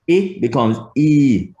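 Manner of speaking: i held long and falling; long vowel. Shown as a demonstration. Teacher showing Jamaican vowel length, long i. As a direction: stretch the i out. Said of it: "just stretched out in time".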